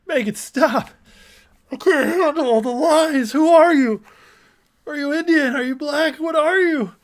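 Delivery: high, loud put-on wail; mock hysterics. Host reacting to all the lies, mock hysterical wailing. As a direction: play a tearful meltdown, hammed up. Mock breakdown over played clip.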